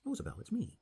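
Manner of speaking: high eager call, name lifted; crying out. A narrator's reading. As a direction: reach out to her with joy. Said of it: "Miss Harper cried out".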